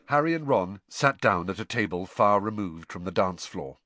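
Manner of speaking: plain reading voice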